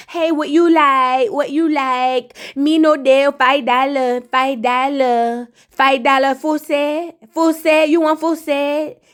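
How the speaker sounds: slow, drawn-out sales chant in a put-on voice; Pinky Doll. Imitating a woman pitching nail services, slow mimicking voice. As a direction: imitate her, stretching out the repeated offers. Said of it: "that's how she sound".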